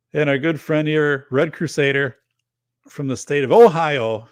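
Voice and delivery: hammed-up loud intro of a viewer; announcer-style intro. Real, not acted. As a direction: introduce him like a ring announcer.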